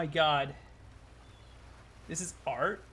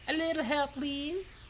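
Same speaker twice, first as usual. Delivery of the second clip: Huntress; 8 semitones higher, slower than usual. Shown as a demonstration. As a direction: try out a lilting woman's plea. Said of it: "what's a Huntress voice?".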